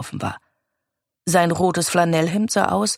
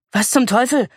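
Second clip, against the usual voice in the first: shocked cry, higher and louder; startled exclamation; 6 semitones higher, 4 dB louder than usual. A narrator's reading. Boy waking to find police beside him: startled cry. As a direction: blurt it out, jolted awake. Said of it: "rief er erschrocken".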